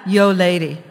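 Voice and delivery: put-on loud Philly accent "Yo, lady". Parrot hails the lady in a Philly accent. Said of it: "He was from Philly".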